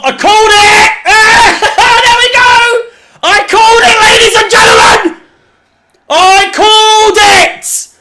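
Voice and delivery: football fan crowing he called the sending off; gloating victory shout. Real, not acted. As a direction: roar it like you predicted the red card. Fan's prediction of red card comes true.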